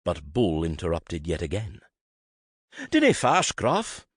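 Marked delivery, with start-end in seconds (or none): 2.9-4.1 loud and much higher, bright and breezy Scots brush-off; cheerful announcement. A narrator's reading. Bull cheerfully dismissing Griffin while backing out of the room. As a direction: toss it off cheerfully as he leaves. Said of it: "he announced cheerfully".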